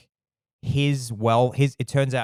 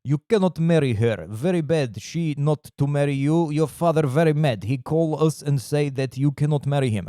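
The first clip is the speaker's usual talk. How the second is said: put-on heavy Russian accent, higher than usual; menacing Russian thug; 4 semitones higher than usual. Gangsters warn the stripper off the marriage, menacing Russian accent. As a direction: play a Russian gangster laying down the law. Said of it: "It's, like, a bit menacing".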